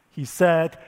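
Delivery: usual speaking voice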